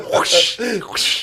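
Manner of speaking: high whooshing anime sound; imitated whoosh. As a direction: make a cartoon power-move whoosh. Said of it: "They're like".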